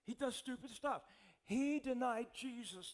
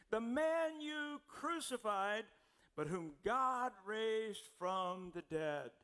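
slow emphatic preaching; 4 dB louder, much slower than usual. Acted. Preacher reads Acts climax.

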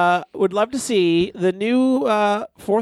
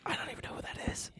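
unvoiced whispered aside; whispering; 20 dB quieter, faster than usual. Real, not acted. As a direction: whisper it to yourself.

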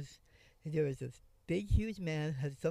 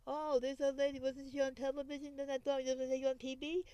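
much higher, gushing gossip voice; mocking passersby; 9 semitones higher than usual. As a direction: lift the pitch way up like excited neighbours. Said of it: "people go".

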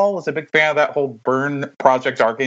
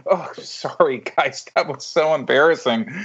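ordinary talk

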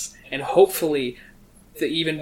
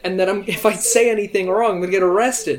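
loud, raised bickering tirade; heated mock rant; 5 semitones higher, 7 dB louder than usual. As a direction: argue over the top, voice lifted. Mock quarrel over wall colour.

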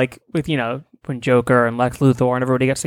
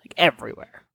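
whispered aside; 2 semitones lower, 5 dB quieter than usual. Real, not acted. Quiet aside.

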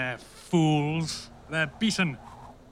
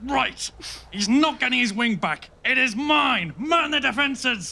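commanding rally; 9 semitones higher than usual. Acted. King rallies defences.

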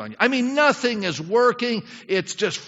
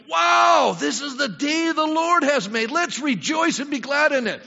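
high, loud wow, then ringing proclamation; joyful praise; 8 semitones higher, 3 dB louder, slower than usual. Acted. Believer getting up to rejoice in the Lord's day, exultant. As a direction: greet the morning with acted exultation.

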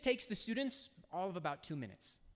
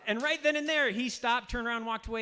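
higher and louder, building; rising excitement at the payoff; excited telling; 7 semitones higher, 8 dB louder than usual. Real, not acted. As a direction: tell it like the punchline hits. Climax of the story, building up.

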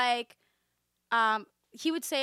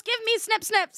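much higher, louder cutesy voice; mock childish request; 11 semitones higher, 7 dB louder than usual. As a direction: play the heroine asking like a child. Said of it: "She goes".

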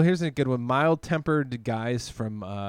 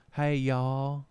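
put-on Southern accent. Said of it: "Guys with the Southern accents".

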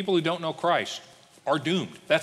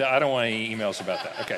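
normal talking voice

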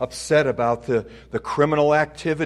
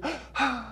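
swooning gasp; 3 dB quieter than usual. Acted. Mock wife swooning.

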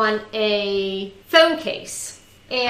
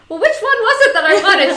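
laughing; 9 semitones higher, 8 dB louder than usual. Retold or imitated. Retelling husband's question, laughing.

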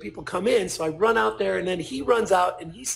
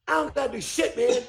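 high, cocky rock-star retort; mock-indignant comeback, voice raised in pitch; retold defiant refusal; 5 semitones higher than usual. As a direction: imitate a singer brushing off a fan, pitched up. Acting singer's refusal of a selfie.

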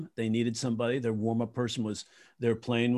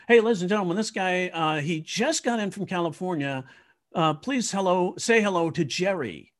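lightly voiced emcee; 6 semitones higher than usual.

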